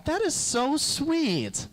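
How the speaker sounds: high, slow swooning coo; drawn-out swoon over the gesture; mock gushing girlfriend. Girlfriend swooning at the buddy's gesture, high coo.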